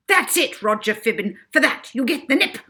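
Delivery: high, loud, vicious threat; furious matron. Acted. Miss Addiston threatening Roger, high and loud. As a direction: threaten him with the nip.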